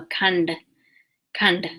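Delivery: "khand" with the final D curled back; tongue back on the "nd" of "khand"; retroflex D. Shown as a demonstration. Teacher applying the sound to a word, retroflex D. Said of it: "I have the tongue back".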